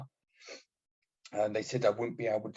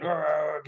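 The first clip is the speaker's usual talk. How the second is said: higher, louder mock Father Jack cry; Father Jack groan; 6 semitones higher, 5 dB louder than usual. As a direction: let out a hammed-up old-priest groan. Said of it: "Father Jack going".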